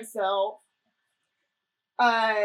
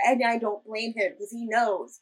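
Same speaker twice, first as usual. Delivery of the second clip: ordinary talk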